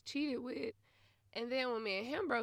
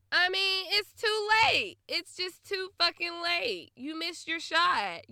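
loud rant; 6 semitones higher, 6 dB louder, slower than usual. Retold or imitated. Ranting at the ex.

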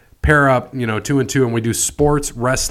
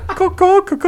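high-pitched mock parrot caws; repeated silly bird call; parrot squawk; 3 dB louder than usual.

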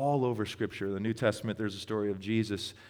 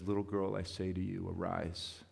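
hushed command; 3 semitones lower, 7 dB quieter than usual. Retold or imitated. Jesus raising the girl, retold.

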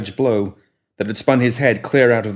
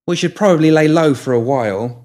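put-on higher, louder bright young woman; cheerful young woman; 6 semitones higher, 5 dB louder than usual. A narrator's reading. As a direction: voice her brisk and eager. Molly voiced in the story.

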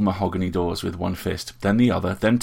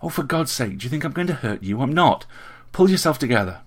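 exasperated hiss; 6 semitones higher than usual. A narrator's reading. Gregory gripping frightened Richard by the sleeves, exasperated hiss. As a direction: scold urgently, pitch up, landing hard at the end. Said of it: "he hissed with a hold".

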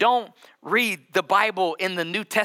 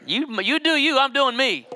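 higher, sassy dismissal; dismissive brush-off; 9 semitones higher, 3 dB louder than usual. Retold or imitated. Husband brushes off the person who walked in, sassy and higher. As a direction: wave them off, sassy and higher.

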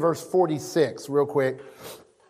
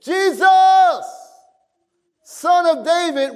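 crying out; 9 semitones higher, 4 dB louder than usual. Acted. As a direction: call out high, pleading to be heard. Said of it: "He began to cry out".

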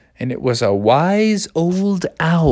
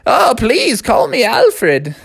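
put-on wise old owl; 9 semitones higher, slower than usual. Old owl corrects boy.

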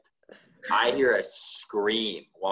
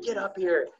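urgent call; 10 semitones higher than usual. Retold or imitated.

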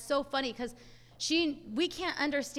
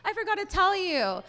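high, startled gasp of a realization; suddenly remembering, embarrassed; surprised neighbor; 7 semitones higher than usual. Retold or imitated. Neighbor telling mom she forgot to tell her, surprised. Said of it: "And she's like".

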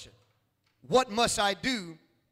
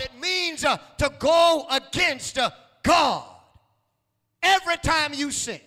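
louder preacher shouts; preaching at full force; 3 semitones higher, 4 dB louder than usual. Acted. Preacher warning against going against God, shouting. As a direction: climb and shout it at them.

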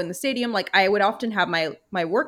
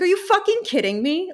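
much higher, louder incredulous cry; retold outrage; 12 semitones higher, 4 dB louder than usual. Hearing the ten-dollar locker fee, outraged disbelief. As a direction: shoot way up in pitch in disbelief. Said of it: "I was like".

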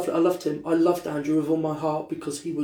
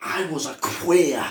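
put-on Scottish accent; 4 semitones lower, 5 dB quieter than usual. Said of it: "I can't do a Scottish accent".

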